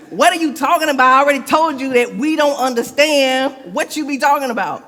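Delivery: exasperated. Acted. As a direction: throw up your hands at the riddles. Thomas exasperated with Jesus.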